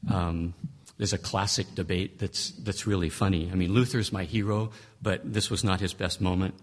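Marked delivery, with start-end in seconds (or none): none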